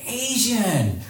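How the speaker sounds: high-pitched mock yell from far off; a girl shouting across the steps, mock yell; retold yell. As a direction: call it out like a stranger across a room. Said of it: "and they just yell".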